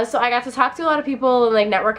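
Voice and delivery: usual speaking voice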